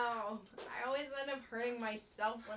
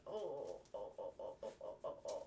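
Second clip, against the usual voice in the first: low cooing; 9 dB quieter than usual. Real, not acted. Cooing low while scratching.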